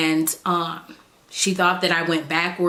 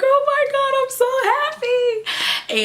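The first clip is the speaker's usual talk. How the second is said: excited squeal. Retold or imitated. Patient on the table greeting her old OB, excited squeal. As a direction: gush with delight at a friend. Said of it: "I was so excited".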